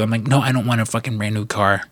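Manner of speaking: normal talking voice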